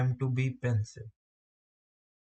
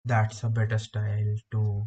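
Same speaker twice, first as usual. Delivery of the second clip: unhurried, weighty phrases broken by silences; calm, deep, with pauses; 2 semitones lower than usual. Shown as a demonstration. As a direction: speak calmly and deeply, pausing for impact. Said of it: "really calm, deep, with taking pauses".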